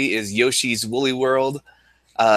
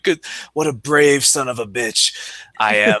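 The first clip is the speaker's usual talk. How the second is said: slow, proud hero voice; mock heroic; slower than usual. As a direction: puff up like a brave soldier. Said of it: "I was like".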